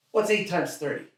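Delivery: high-pitched, insistent father voice; quizzing dad. Acted. Dad testing teen with farmer logic, insistent. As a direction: fire the sum at your kid.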